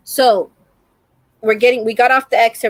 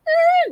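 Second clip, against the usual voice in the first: short high-pitched screech; imitated car noise. Showing how the car swerved, high squealed car noise. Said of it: "And he's, like".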